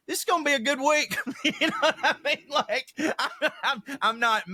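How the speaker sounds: high, laughing recollection. Real, not acted. Comedian remembering election week, laughing high. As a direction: giggle through the words. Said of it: "laughs at own memory".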